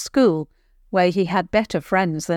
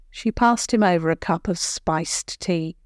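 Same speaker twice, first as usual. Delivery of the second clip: ordinary delivery, nothing marked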